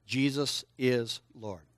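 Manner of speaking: slow, spaced-out words to repeat; word-by-word prompt. Shown as a demonstration. Preacher leading kids in confession, word by word. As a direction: say it slowly, one word at a time.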